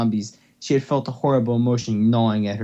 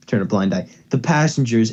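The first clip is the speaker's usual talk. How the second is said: ordinary delivery, nothing marked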